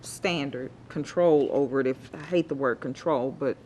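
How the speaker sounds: ordinary talk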